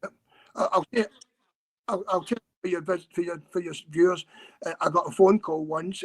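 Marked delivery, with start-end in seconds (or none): none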